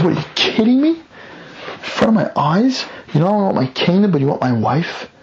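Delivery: high, shocked outrage; outraged king. Acted. The king catching Haman on top of Esther, high outrage.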